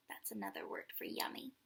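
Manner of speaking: quick unvoiced whisper to the kids; whispered aside. Shown as a demonstration. Storyteller whispering a word tip to children. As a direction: lean in and whisper the meaning. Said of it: "aside explaining a word to children".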